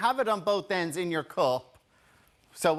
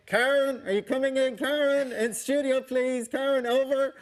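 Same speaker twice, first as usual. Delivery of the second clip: radio operator call; 6 semitones higher than usual. Acted. Radio call through cup phone.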